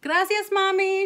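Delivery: put-on high, loud cheerful kid; singsong parting shout; calling out. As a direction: call thanks to mom on the way out. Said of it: "she called".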